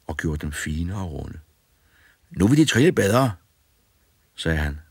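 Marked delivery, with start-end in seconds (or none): 2.3-3.3 put-on boy's voice, higher and louder; cheeky boy. A narrator's reading. Fearless boy bossing the ghosts, cheeky and loud. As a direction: bold lad ordering the ghosts about.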